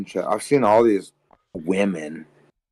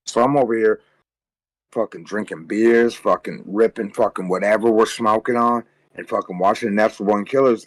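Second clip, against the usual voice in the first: ordinary talk, nothing marked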